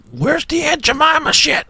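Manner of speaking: high, slow mock-kid whine; imitating complaining kids. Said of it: "the kids, you know, they're".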